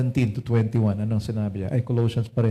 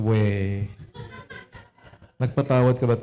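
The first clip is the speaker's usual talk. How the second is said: mock Lord voice; 2 semitones lower than usual. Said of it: "Sabi ni Lord".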